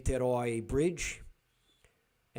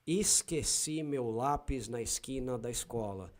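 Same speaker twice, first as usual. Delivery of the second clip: São Paulo Portuguese, crisp "s" in esquina and escola; São Paulo accent. Shown as a demonstration. Repeating the funny sentence as Paulistas say it, São Paulo accent. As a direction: keep every S a plain "s", Paulista style. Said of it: "Paulistas will say".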